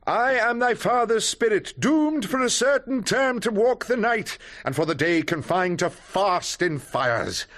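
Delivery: high, declaiming Shakespeare ghost; hammed-up ghost. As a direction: proclaim it like the ghost of Hamlet's father. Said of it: "Please stop now".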